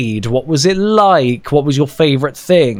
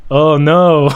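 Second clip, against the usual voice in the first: mild mock dismay. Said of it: "the teacher going".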